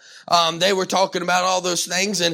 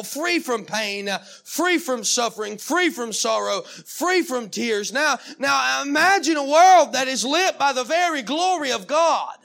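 rhythmic, building sermon cadence; fervent preaching; 4 semitones higher than usual. Real, not acted. Preacher urging congregation to imagine heaven, fervent preaching. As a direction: preach it in rolling, insistent phrases.

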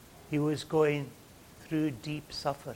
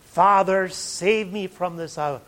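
loud, higher cry to the Father; struggling plea; 4 semitones higher, 11 dB louder than usual. Retold or imitated. Preacher voicing Jesus in his struggle before death, crying out. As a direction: cry it out as an urgent prayer. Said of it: "we see in his struggles resignation".

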